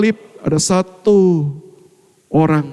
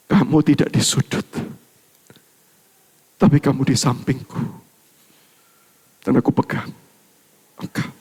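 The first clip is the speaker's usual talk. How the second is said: tender Jesus; 5 semitones lower than usual. Acted. (Yesus menjawab dengan lembut)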